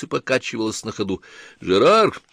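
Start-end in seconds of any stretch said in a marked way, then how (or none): 1.6-2.2 high urgent shout. Acted. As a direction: call out in alarm, drawn out. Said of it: "крикнул Ланселот".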